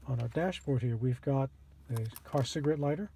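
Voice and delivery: usual speaking voice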